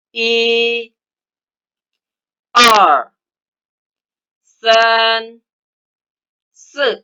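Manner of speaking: each Chinese number called out with its tone; Mandarin count. Shown as a demonstration. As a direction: lead the class through the count. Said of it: "kita bersama-sama melafalkan angka satu sampai sepuluh".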